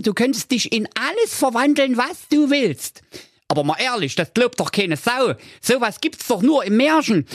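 very high cheeky dog voice; squeaky, sly and goading; Doktor Schulz. Acted. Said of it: "Doktor Schulz sprach". (frecher Hund mit hoher Stimme)

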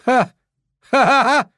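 high, loud gloating ha-has; exultant full-voiced cheer; triumphant shout. A narrator's reading. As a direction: crow with joy that they're gone. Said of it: "rief ich".

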